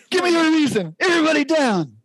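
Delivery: high, put-on cop-show shout; tough TV cop. Pitching Boise Vice, doing the cheesy TV cop. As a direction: bark it like a cheesy TV detective busting in.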